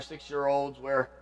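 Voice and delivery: normal talking voice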